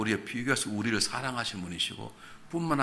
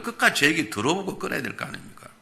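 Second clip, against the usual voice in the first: ordinary talk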